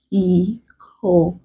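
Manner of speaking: ECONOMICAL as e-co-no-mi-cal with long gaps; broken into syllables. Shown as a demonstration. Teacher breaking a word for students, ECONOMICAL syllable by syllable. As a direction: say "economical" one isolated syllable at a time. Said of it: "Let's break".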